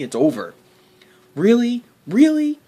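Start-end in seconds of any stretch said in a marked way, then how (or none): 1.3-1.8 high, loud disbelief; really, are you serious; shrill outrage at the booking decision; incredulous. Real, not acted. 2.1-2.6 higher, louder disbelief; even more incredulous. Real, not acted. Outraged at the booking.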